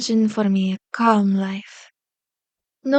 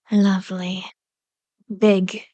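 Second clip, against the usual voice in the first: usual speaking voice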